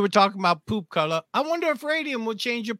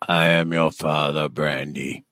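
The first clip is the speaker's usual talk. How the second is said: much lower, booming sci-fi villain; deep villain voice; slower than usual. Acted. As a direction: drop into a menacing space villain. Movie villain line, voice dropped deep.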